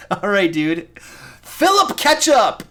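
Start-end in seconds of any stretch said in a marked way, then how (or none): 1.6-2.6 higher, louder, slow hyped call of the name; excited shout. Real, not acted. Streamer cheers a viewer's nickname, excited and loud. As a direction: hype up the viewer's silly name.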